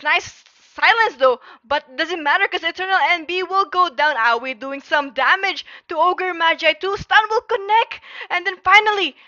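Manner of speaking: high, loud caster hype; live play-by-play. Acted. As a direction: keep the fight call rolling. Teamfight play-by-play.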